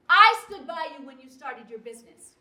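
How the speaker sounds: loud, indignant reproach. Acted. Wife reminding husband she backed his business, loud and indignant. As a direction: throw it back at him forcefully. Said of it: "Don't raise your voice".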